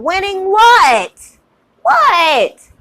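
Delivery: high, loud, drawn-out disbelief; incredulous angry shout. Real, not acted. Mocking critics who claim they're winning, loud incredulous shout. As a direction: bark the question back in disbelief. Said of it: "I am heated".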